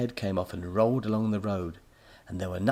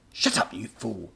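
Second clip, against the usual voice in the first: mild snap. A narrator's reading. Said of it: "the professor snapped".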